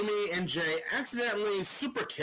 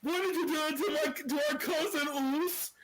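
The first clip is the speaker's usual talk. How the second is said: imitating a panicked Jey; 8 semitones higher than usual. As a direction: whine it up high like a stunned brother begging for answers. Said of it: "Jey is sitting there going".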